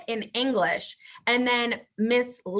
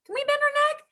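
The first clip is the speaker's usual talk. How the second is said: excited; 13 semitones higher, much faster than usual. Acted. Teacher cheers kids on.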